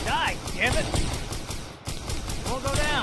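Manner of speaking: furious gamer shout; angry shout. Real, not acted. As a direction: yell at the target, high and loud. Shooting at enemy, frustrated.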